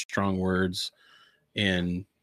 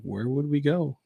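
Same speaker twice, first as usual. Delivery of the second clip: higher, animated voicing of bewildered followers; incredulous disciples; 6 semitones higher than usual. Disciples answering Jesus on whether they'll leave, incredulous. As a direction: voice their exasperated loyalty, pitched up.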